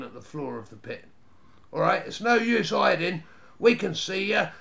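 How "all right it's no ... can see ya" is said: loud, higher bluffing call; boy calling up the pit to bluff a hider. A narrator's reading. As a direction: call out to flush a hidden enemy. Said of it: "called the youngest Snarget".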